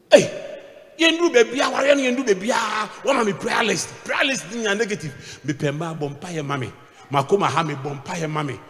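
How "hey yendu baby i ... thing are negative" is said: high, loud mock prayer cry; hammed-up loud prayer plea; mock shouted prayer. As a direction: ham up a desperate prayer shout. Preacher mocks shouted prayer.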